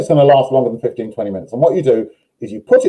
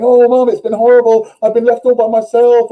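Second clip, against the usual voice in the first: much higher, whiny put-on dog voice; excited dog voice; 11 semitones higher, 4 dB louder than usual. Dog complaining to its owner about being left, in a high whiny voice. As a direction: play the needy dog crying to Mum. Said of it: "the dog is all excited and going".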